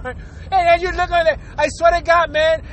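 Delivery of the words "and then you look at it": usual speaking voice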